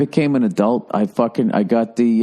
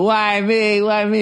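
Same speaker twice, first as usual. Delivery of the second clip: mock whining; 8 semitones higher, slower than usual. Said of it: "Tony V had this bit when she was going".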